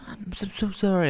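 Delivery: imitating a nervous kid saying sorry; mock sorry pleading. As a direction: squeak out a guilty sorry. Retold apology to tech guy.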